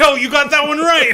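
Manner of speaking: loud, high, amused holler; laughing shout. Real, not acted. Reassuring co-host about the name, laughing shout.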